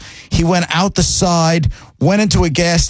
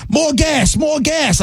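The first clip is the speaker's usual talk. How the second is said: high, shouted "more gas" in a mock foreign driver voice; mimicking angry driver; 10 semitones higher, much slower than usual. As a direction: bark it like the furious driver. Angry driver voiced in retold argument.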